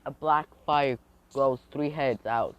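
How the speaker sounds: plain reading voice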